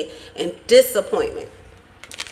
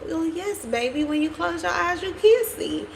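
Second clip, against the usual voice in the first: patient grandma; 8 semitones higher, 4 dB quieter than usual. Retold or imitated. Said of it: "And she was like".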